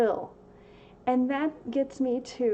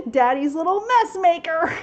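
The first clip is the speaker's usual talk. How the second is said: loud, high teasing call broken by a laugh; laughing mock announcement; playful call; 6 semitones higher, 8 dB louder than usual. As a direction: call it out with a chuckle. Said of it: "that's a little, a euphemism for".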